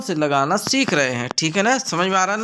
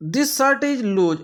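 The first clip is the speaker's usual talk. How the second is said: very slow, higher reading; slow reading aloud; 6 semitones higher, much slower than usual. Shown as a demonstration. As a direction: read each word out slowly. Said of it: "reads option slowly".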